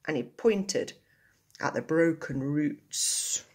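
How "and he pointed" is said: usual speaking voice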